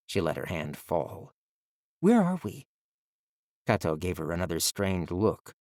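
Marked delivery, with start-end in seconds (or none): 2.0-2.7 put-on high, puzzled girl voice; ask, lost and confused; girl's voice. A narrator's reading. Girl asking boy, lost.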